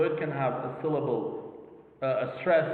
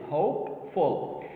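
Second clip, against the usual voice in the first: "Hopeful" slowly split, HOPE leading; stress on first syllable; slower than usual. Shown as a demonstration. Teacher counting two syllables here, "Hopeful" stressed on HOPE. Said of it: "the stress is in the first syllable".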